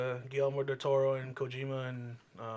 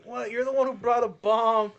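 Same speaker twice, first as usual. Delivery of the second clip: exasperated; 9 semitones higher, 9 dB louder than usual. Retold or imitated. Star-Lord arguing with Rocket.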